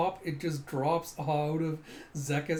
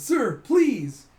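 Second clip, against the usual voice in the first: high, loud mock plea; hammed-up pleading cry; loud begging; 9 semitones higher, 11 dB louder than usual. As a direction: beg desperately on your knees. Buttkiss bows down begging.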